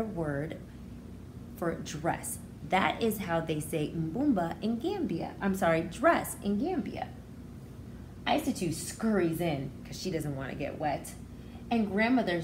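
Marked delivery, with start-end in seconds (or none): none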